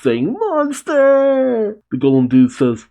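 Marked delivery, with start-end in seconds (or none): 0.4-1.8 very high, drawn-out shriek; hammed-up scream of terror; mock terrified shriek. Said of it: "But Yakuto still shrieks".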